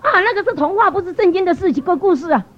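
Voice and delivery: high-pitched indignant protest; angry retort. Retold or imitated. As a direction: snap back in retold annoyance. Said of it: "我一听了我就很生气了".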